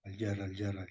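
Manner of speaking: soft repeated demo of "al-yerra"; Hispano-Arabic pronunciation. Shown as a demonstration. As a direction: quietly show how Hispano-Arabic speakers said "al-yerra". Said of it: "en árabe hispánico se escuchaba".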